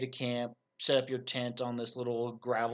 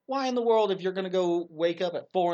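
much higher and louder outburst; exasperated shout; 6 semitones higher, 6 dB louder than usual. Real, not acted. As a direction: burst out in disbelief. Ranting about sports signs.

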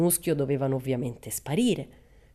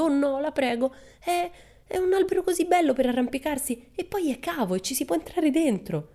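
put-on high, imploring little-girl voice; pleading girl; 12 semitones higher than usual. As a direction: beg the gentleman to spare the tree. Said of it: "a mani giunte".

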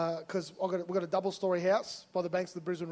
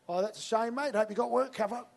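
higher, louder, matey and dismissive; casual brush-off; 5 semitones higher, 4 dB louder than usual. Shown as a demonstration. Preacher acting out the easy response to Russ, casually dismissive. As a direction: toss off sympathy and move on. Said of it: "Option one".

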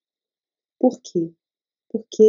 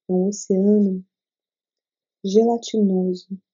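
guided meditation. Acted. Leads a meditation.

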